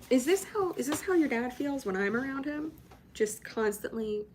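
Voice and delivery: high-pitched, fond wondering; cooing over dog. Real, not acted. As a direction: gush over a clingy pet, pitched well up.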